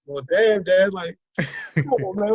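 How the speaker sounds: mock-wounded, high-pitched pleading; retold protest. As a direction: imitate a son protesting to his tough dad. Retold protest to scolding dad.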